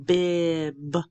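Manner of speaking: BIB drawn out slowly; stretched out. Shown as a demonstration. Teacher blending sounds for students, BIB slowly stretched out. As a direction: hold the middle of BIB long. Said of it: "say it slowly and stretched out".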